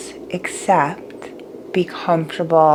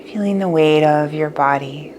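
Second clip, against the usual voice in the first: guided meditation; 6 dB quieter than usual. Acted.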